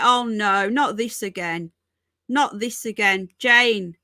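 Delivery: ordinary talk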